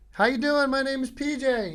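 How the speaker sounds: high, loud yell over bar noise; yelling over music. Retold or imitated. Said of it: "I'm like".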